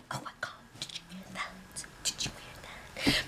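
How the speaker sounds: frantic whispering; panicked whisper. Retold or imitated. Houseguests overhearing kitchen talk, panicked whisper. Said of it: "start freaking the F out".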